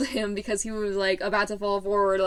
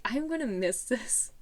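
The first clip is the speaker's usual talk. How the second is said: soft and slow, hushed and tender; wistful; 7 dB quieter than usual. Retold or imitated. Hugging the teacher goodbye, soft and tender. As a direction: say it softly into the hug. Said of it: "I was like".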